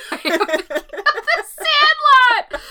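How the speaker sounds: high, loud laughing admission. Real, not acted. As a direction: crack up at your own mistake. Laughing at own mixup.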